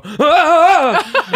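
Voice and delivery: sudden high loud yelp, laughing; imitated startled scream. Describing startled New York women, a high loud laughing yelp. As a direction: mimic a woman jumping at a passerby. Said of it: "she'll just go".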